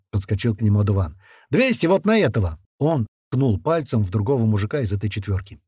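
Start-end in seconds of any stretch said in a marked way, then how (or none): 1.5-2.6 high excited gambler's call; eager bet. A narrator's reading. Bystander calls a bet.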